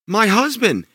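shouted alarm. Acted. Giantess hearing her husband coming, alarmed shout. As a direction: panic at the rumble. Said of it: "shouted the giantess".

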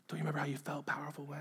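quick, very soft whisper; tempting whisper. Acted. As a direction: whisper the temptation, hurried and hushed. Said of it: "you're being whispered".

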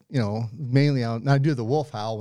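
ordinary talk

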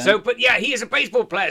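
ordinary delivery

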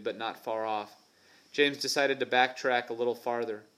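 usual speaking voice

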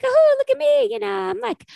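high, put-on girly voice; high mock voice. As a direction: squeal it in a showy high voice. Imitating Phoebe showing off.

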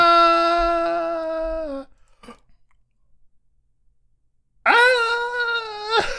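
high, held "aah" cry; drawn-out fake-crying moan; long mock wail. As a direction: wail on one sustained high note. Wordless long wail after teasing.

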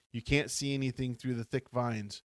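ordinary delivery

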